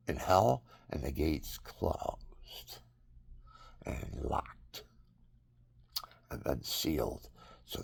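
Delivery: slow, hushed whisper about hell; slow sermon whisper about the gates of hell being sealed; ominous whisper. Real, not acted. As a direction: breathe it out like a dark secret.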